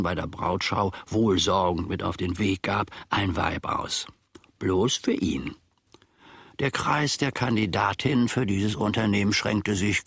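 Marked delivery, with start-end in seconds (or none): 4.6-5.5 hushed, secretive whisper; whispered aside. Acted. Servant confides to the audience in a whisper. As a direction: let it slip as a confidential aside.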